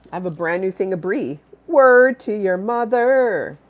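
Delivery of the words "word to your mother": slow slangy drawl. Pretend. Slang catchphrase.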